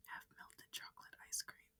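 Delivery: breathy whispered repeat. A narrator's reading. Narrator whispering to the listener in a hush. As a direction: whisper it back to yourself.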